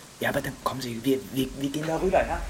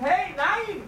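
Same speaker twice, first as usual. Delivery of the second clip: high frightened shout of no; panicked cry. Acted. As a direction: cry out as the door shuts. Left alone with the man.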